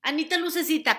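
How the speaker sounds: mock frustrated inner scolding; exasperated self-talk. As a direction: beg your own mind to shut up. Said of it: "tienes esos diálogos con tu voz".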